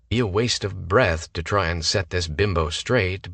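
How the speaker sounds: ordinary delivery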